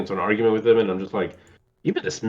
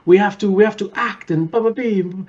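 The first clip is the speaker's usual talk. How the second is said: high, theatrical hammed-up outrage; mock-heroic proclamation, pitched way up; Raul Julia's grand speech; 10 semitones higher than usual. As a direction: declaim it like a stage hero demanding action. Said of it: "and he, like, he, like".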